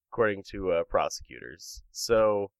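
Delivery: ordinary delivery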